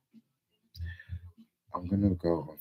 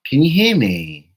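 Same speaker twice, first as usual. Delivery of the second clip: louder, higher call; 6 semitones higher, 12 dB louder than usual. Real, not acted. Checking her live audience can hear her, calling out.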